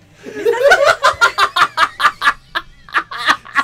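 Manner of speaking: high, explosive burst of laughter; big high-pitched laughing fit; loud laughter. Real, not acted. Burst of laughter at inside joke.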